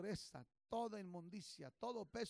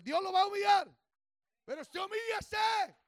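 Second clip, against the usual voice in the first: preaching shout; 12 semitones higher, 13 dB louder than usual. Real, not acted. Preacher exhorting congregation.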